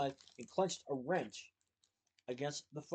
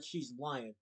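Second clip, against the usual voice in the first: usual speaking voice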